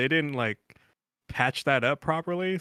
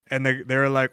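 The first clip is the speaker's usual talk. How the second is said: usual speaking voice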